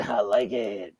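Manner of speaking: dropped low with a mock shout; low growly voice. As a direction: growl it out deep. Says it twice, differently.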